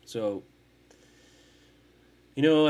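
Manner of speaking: ordinary delivery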